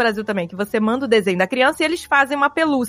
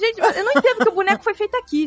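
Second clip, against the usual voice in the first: mom's fake confusion; 10 semitones higher than usual. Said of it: "Aí eu".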